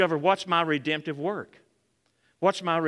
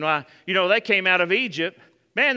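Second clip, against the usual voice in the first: normal talking voice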